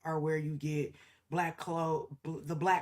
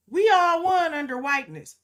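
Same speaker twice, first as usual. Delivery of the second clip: higher and louder mock declaration; mock immigrant claim; 9 semitones higher, 8 dB louder than usual. Mocking immigrants who claimed whiteness. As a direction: lampoon the newcomers' boast. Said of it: "Y'all came over here and was like".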